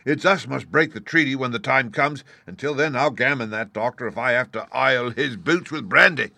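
hearty, emphatic pirate bluster; forceful cry. A narrator's reading. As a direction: shout down a doubting crew. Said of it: "he cried".

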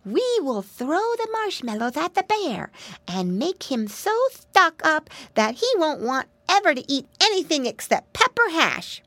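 high-pitched little pig voice; excited piggy boy. Acted. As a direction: lay out a plan as an eager piglet. Voices Flop's excited plan.

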